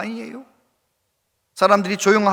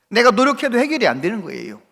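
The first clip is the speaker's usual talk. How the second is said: normal talking voice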